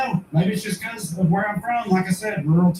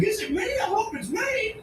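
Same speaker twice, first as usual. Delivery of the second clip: high, softer talking-dog voice; eager dog voice; 6 dB quieter than usual. Acted. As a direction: plead hopefully as the dog.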